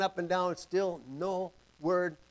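usual speaking voice

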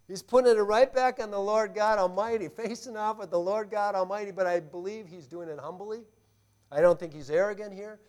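ordinary delivery

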